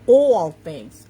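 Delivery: loud New York accent, drawn-out "awl". Real, not acted. Coach stressing the promise to viewers, New York accent. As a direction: lean hard on a New York "all". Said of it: "That's my New York accent coming out".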